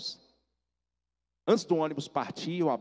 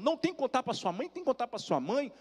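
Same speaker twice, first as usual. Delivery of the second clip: high, insistent voice of an aunt; imitated tearful urging; 11 semitones higher than usual. Aunt hugging nephew, mock-tearful and insistent. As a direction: act out emotional urging without real sobs. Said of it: "ela me abraçou chorando".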